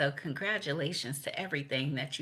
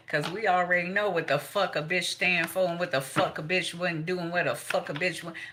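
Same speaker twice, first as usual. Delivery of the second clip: ordinary talk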